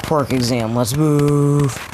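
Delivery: rally the students with a drawn-out call; calling the class. Acted. Teacher announces exam to class.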